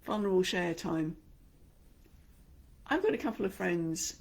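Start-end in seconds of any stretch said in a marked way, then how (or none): none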